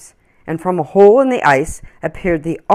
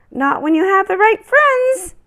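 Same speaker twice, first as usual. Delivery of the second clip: much higher, louder birdlike reassurance; cheerful little bird; 5 dB louder than usual. Acted. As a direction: chirp it back like a friendly bird. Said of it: "the bird answered".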